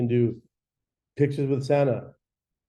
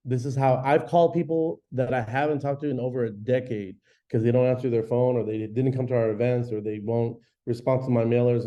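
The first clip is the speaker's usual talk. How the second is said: normal talking voice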